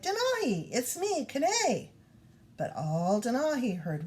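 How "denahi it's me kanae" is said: high pleading call to his brother; crying out. Acted. Kanae calls out to his hunting brother. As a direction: call out desperate to be recognised. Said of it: "Kanae cried".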